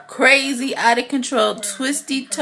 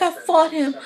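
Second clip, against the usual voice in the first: high, pleading voice for Melly; imitating her desperate insistence; 6 semitones higher than usual.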